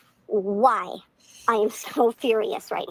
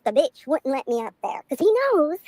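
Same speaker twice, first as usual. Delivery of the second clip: ordinary delivery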